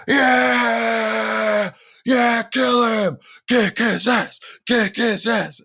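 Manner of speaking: imitated crowd roaring for a beating; crowd screaming. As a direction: scream it like a fan in the stands. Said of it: "I hear the crowd screaming".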